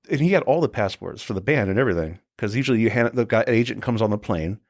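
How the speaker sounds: ordinary talk, nothing marked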